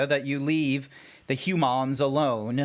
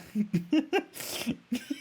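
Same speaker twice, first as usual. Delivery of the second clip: high, trailing giggle; helpless high laughter that tails off softly; laughing; 10 semitones higher, 5 dB quieter than usual. Real, not acted. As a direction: crack up at your own joke. Cracks up at own joke.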